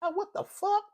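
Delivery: high stunned what-the-hell; stunned disbelief. Retold or imitated. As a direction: squeak out your disbelief at the slammed door. Reacting to slammed door.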